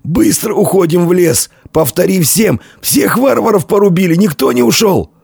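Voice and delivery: high-pitched, strained commander's cry; urgent orders. A narrator's reading. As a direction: press the men to move. Hoarse commander gives orders.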